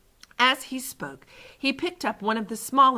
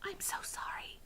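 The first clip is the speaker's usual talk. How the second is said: very soft, voiceless sorry; whispered apology; 16 dB quieter than usual. A narrator's reading. Sophie apologises into the giant's ear, whispering. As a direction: whisper it so as not to hurt. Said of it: "Sophie whispered".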